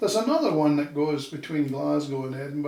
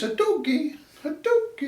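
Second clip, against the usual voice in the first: doggy swooping much higher, sing-song Dundee lilt; sing-song Dundee accent; 13 semitones higher than usual. Retold or imitated. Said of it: "more sing-songy".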